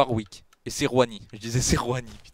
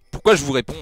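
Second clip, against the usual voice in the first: exasperated; 4 semitones higher, 11 dB louder than usual. Real, not acted. Fed up with chat debates.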